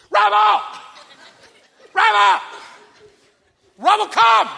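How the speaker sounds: high, loud dog call; repeated booming shouts; really loud voice. Retold or imitated. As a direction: holler across the yard for the dog. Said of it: "I have a really loud voice".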